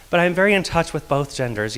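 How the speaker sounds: ordinary delivery, nothing marked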